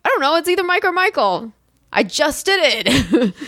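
high-pitched exasperated retort; acted annoyance, dragging out the end; annoyed. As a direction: imitate someone fed up with being questioned. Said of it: "he was pretty annoyed".

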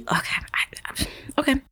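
breathless whispered okay; stunned whisper. Real, not acted.

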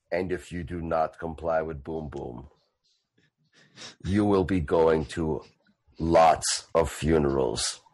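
low, quiet and slow menace; deep, measured death threat; threatening brute voice. Acted. Boom Boom threatens the dwarf.

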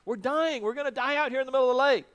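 frightened disciples begging Jesus to wake; panicked cry for help. Acted. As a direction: cry out to Jesus as the boat sinks. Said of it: "they call out".

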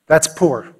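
ordinary talk, nothing marked

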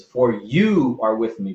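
"you" leaned on, slowed, falling; stress on you. Shown as a demonstration. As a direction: lean into "you" in "For you are with me". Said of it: "he makes a switch in his language".

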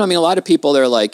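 usual speaking voice

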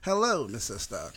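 low, soft, slow AI butler; hushed low assistant voice; lightly imitated Jarvis. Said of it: "I think that Jarvis doing it".

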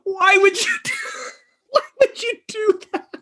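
very high, squealed through laughter; real cracking up, barely getting words out; helpless laughter. Real, not acted. Storyteller aghast at the charity gig, helplessly laughing.

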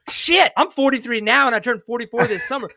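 surprised retelling of doing the math; mock realization. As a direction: catch yourself mid-math. Retold realization of own age.

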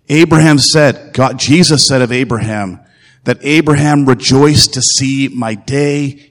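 normal talking voice

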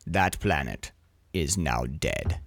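low, slow booming God voice. Retold or imitated. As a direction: intone it like a solemn deity. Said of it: "the voice of God comes out of nowhere".

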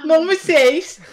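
high, loud mock of her line; mocking imitation. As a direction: tease by parroting her accent. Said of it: "Why did your hands have to go into a praying mantis".

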